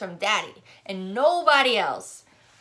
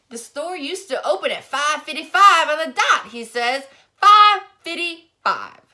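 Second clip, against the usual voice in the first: put-on higher, louder cranky old barber, dragging the last words; grumpy old man; 8 semitones higher, 11 dB louder than usual. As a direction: scold like a fussy old neighbour.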